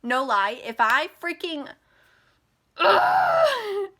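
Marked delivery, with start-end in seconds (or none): 2.8-3.9 high, loud frustrated ugh; exasperated scream. Real, not acted. Venting to viewers about the man, real angry scream. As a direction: let the rage burst out in one high cry. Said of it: "I'm getting so mad right now".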